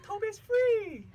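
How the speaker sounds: high, slow squeaky elf cry. Shown as a demonstration. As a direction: squeal it like a freed house elf. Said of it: "It's like".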